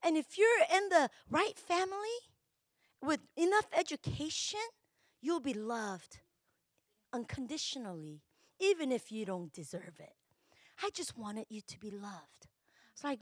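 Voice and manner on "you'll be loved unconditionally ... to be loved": soft, hushed voice of God; God speaking softly of love; gentle whisper. Retold or imitated. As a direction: whisper the promise tenderly. God's gentle answer voiced.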